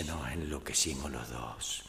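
slow, low, soft confiding; hushed confession. Acted. Woman confessing her night to the priest, hushed and slow. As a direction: linger softly over each romantic detail.

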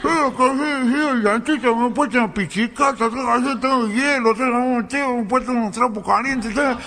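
high muffled whine through a swollen mouth; dolor de muela. Acted. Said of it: "parecía que traía una bocha dentro de la boca". (quejoso, con la boca hinchada)

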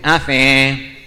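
slurred, blocked-nose asking; hammed-up gangoso customer; nasal gangoso voice. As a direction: mumble like a man with a cleft palate. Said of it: "un gangoso se mete en un kiosco y dice".

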